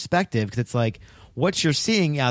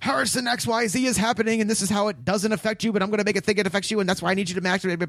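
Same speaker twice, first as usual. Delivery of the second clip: high-pitched, fast ranting pundit; Fox News screaming; 9 semitones higher, faster than usual. Retold or imitated. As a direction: rant at Harrison like a panicking cable host. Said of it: "jump in Harrison's face and say".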